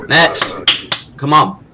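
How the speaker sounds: loud, barked call for the next one; impatient checkpoint shout; gruff guard call. Acted. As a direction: snap at the queue like a bored border guard. Border guard barking at entrants.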